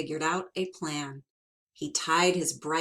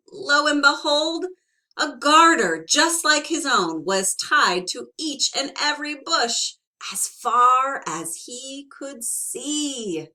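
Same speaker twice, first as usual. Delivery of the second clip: dramatic reveal; 8 semitones higher, 9 dB louder than usual. A narrator's reading.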